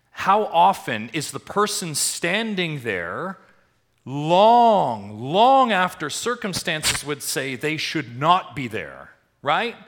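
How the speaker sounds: high, loud, slow preacher's climax; full-voiced pulpit crescendo; preaching at full force. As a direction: stretch "long" and let it ring.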